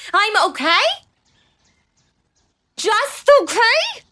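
rising offended disbelief; indignant. Acted. Lynn offended by husband's remark.

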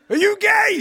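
high, loud laughing yell; mock shout. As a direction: bellow the question in a joking high shout. Acted Russian-roulette question, laughing.

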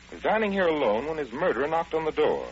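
ordinary talk

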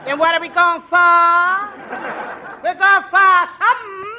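loud, slow, exaggerated repeat; a comic echoing the girl's line. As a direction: belt the question back. Costello repeats, louder.

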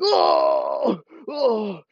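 loud stabbed groan, then high; hammed-up cries of agony; acted pain groans. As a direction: groan as if knifed in the gut. Acting out a stabbed player.

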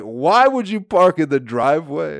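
acted-out indignant yell; livid. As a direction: imitate an irate dad demanding an answer. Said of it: "He's fucking livid".

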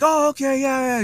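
very high-pitched, hurried-sounding imitation; high-strung agreement. Excitable guy agreeing before running to the next person, high-strung. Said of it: "high strung at first".

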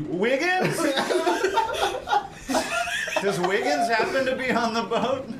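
high, giggling question; laughing. Real, not acted. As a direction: giggle while asking.

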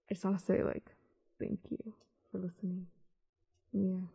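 very quiet, almost fading away; real choked-up thanks, barely voiced; holding back tears. Real, not acted. Host thanking listeners before tears stop her, holding back tears. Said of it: "I had to stop talking, or else I was gonna start crying".

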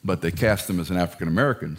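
ordinary delivery

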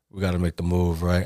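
low, soft conspiratorial murmur; hushed plan to leave; low aside to wife. Retold or imitated. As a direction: say it under your breath to your wife. Telling wife quietly to leave.

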